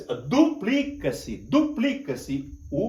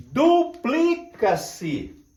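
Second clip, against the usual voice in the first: 1 slow dictation; 6 semitones higher, 3 dB louder, much slower than usual. Shown as a demonstration. Teacher dictates while writing.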